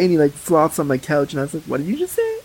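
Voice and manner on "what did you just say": high, softer incredulous question; retold incredulous question, rising at the end; retold disbelief. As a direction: ask it in hushed disbelief, pitched up. Retold startled challenge to escort.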